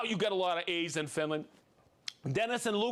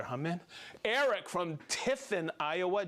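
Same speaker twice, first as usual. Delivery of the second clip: ordinary talk, nothing marked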